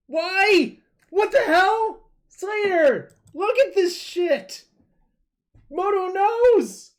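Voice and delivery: very high, loud yelling; real high-pitched shouting; shouted outburst. Real, not acted. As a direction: yell in shrieking disbelief at what just popped up. Streamer's outburst at opening a chest.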